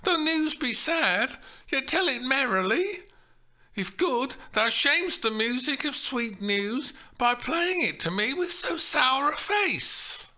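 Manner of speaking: high, youthful Juliet voice; girlish Shakespeare heroine, reading; Juliet read aloud. Acted. Steph reading Juliet's lines at rehearsal, high and eager.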